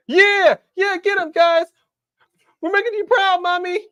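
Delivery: high-pitched shouting cartoon voice; squeaky mock cheer for the boys. Voicing wrestlers on screen.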